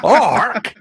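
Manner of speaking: high-pitched imitated grandad squawk; mock old-timer's call for quiet; old granddad hushing. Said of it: "to tell you to be quiet, he'd go".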